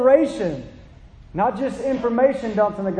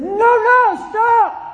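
much higher, louder yell; screamed stop; 12 semitones higher, 6 dB louder, much slower than usual. Retold or imitated. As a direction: shout to stop a child from the street. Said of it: "Daddy screamed at me".

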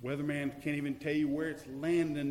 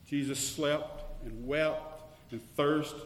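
loud, rising sermon climax; full-voiced fervent preaching; preaching at full force; 3 semitones higher, 6 dB louder than usual. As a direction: drive the truth home to the congregation.

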